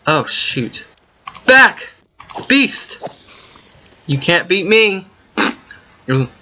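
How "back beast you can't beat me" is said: high, loud shouted taunts at the beast; hammed-up warrior shout; mock battle cry. Real, not acted. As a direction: bellow like a hero holding off a monster. Fighting a mob in game.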